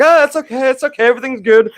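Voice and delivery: high mock comforting voice; hammed-up coaxing, trying to calm a crying child; soothing a toddler. Sitter retelling how he soothed the screaming toddler, high mock comforting. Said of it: "I was like".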